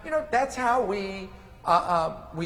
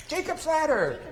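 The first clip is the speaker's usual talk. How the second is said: put-on child asking; 11 semitones higher than usual. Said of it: "she's gonna be more likely to come to me".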